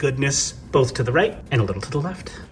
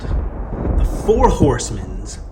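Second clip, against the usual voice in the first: showing off; slower than usual. Pretend. Grand unveiling of toy line.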